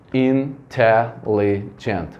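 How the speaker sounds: "intelligent" slowly split into in-te-lli-gent; "intelligent" drawn out slowly, one beat per syllable. Shown as a demonstration. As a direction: count out each syllable of "intelligent" with short gaps. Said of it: "bater palma pra contar a sílaba".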